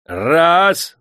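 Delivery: high, loud single count; shouted count. A narrator's reading. Old master counts lashes with his staff, shouting. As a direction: call out the first blow. Paralytic counts the first lash.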